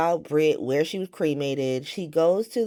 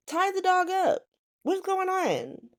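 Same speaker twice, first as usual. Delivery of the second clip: much higher squeaky exasperated voice, slowing on the question; exasperated squeal; 14 semitones higher than usual. Real, not acted. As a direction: squeal it out in disbelief at the loose dog. Exasperated at dog roaming free.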